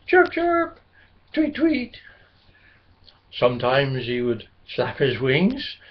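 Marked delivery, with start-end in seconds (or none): 0.1-2.0 high chirping bird calls; birdsong chirps. A narrator's reading. Blackbird singing to his cow friend, high chirps. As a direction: chirp sweetly like a little blackbird. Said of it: "sing to her".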